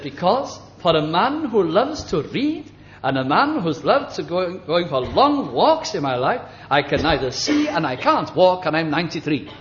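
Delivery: lightly voiced bitter old man; grumpy old patient.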